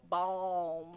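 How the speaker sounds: "Balm" with a long open ah; drawn-out "Balm". Shown as a demonstration. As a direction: stretch the vowel in "Balm". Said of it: "I feel like that's balm".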